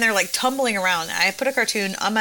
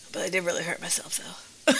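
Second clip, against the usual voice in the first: softer, small admission; imitated hurt child; 4 semitones lower, 9 dB quieter than usual. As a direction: quietly imitate a kid owning up. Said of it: "and he goes".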